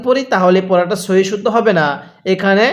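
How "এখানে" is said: normal talking voice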